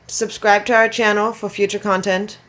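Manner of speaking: normal talking voice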